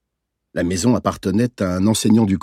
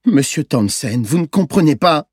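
put-on high, upset child's plea; hiccuping protest; 6 semitones higher, 4 dB louder than usual. A narrator's reading. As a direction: protest as a boy choked by sobs. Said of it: "il a octé". (protestation d'enfant hoquetant, voix haute)

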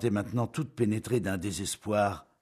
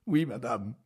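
sobbing; 5 semitones higher than usual. A narrator's reading. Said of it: "Rosalie sanglota".

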